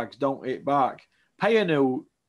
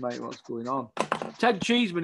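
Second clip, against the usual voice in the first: ordinary talk, nothing marked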